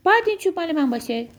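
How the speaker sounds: high, fast storybook shout; excited high-pitched cry, rattled off. A narrator's reading. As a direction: burst out with the demand. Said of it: "فریاد زد".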